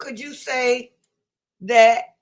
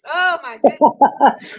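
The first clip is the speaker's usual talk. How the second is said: slow oh my goodness through laughter; laughing; 8 dB louder, slower than usual. Real, not acted. Host cracking up at the caller's Brooklyn greeting, laughing. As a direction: laugh it out, delighted.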